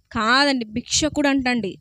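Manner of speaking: normal talking voice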